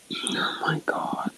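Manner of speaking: slow, breathless whisper of disbelief; hushed disbelief. Real, not acted. Whispering in disbelief while recounting the pizza mix-up. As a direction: barely breathe the words.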